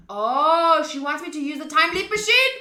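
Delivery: the penny drops out loud; surprised gasp of understanding; sudden realization. Real, not acted. Realizes Suzuha's plan mid-game.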